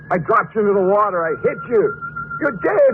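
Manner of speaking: high, rising terror; panicked. Acted. Terrified husband screaming at his dead wife's ghost.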